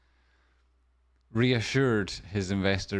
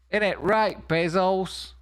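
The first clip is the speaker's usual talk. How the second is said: much higher, louder teasing call; mock jeering; 10 semitones higher, 3 dB louder, slower than usual. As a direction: jeer at Bezos like a heckler. Teasing aside to Bezos.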